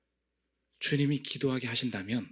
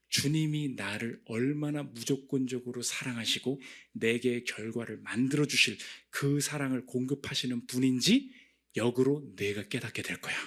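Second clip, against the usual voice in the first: ordinary delivery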